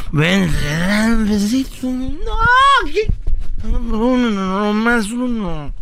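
coaxing, slowed-down begging for kisses; pleading drunk guy. Retold or imitated. Host playing the drunk boyfriend begging his girlfriend for kisses. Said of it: "Y el vato así".

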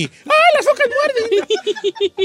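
put-on very high, drawn-out girly squeal; hammed-up falsetto panic with laughter; mock little-girl voice. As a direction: flee shrieking like a little girl. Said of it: "con la voz de niña diciendo".

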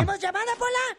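mock high-pitched repeat of the question; playful falsetto echo; squeaky echo. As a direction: squeak the question back in a tiny voice. Host echoes producer, squeaky.

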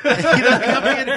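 loud laugh, then the question through laughter; laughs, then turns to the host. Real, not acted. Laughing at own story.

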